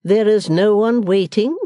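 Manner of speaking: slow, loud, stately echo; majestic. A narrator's reading. Missus Wilfer echoing her daughter in disbelief, majestic. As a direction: repeat it grandly, drawn out and loud. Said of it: "repeated Missus Wilfer in majestic accents".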